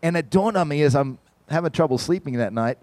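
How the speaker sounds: ordinary talk, nothing marked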